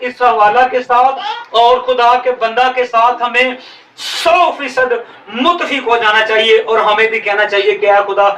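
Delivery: ordinary talk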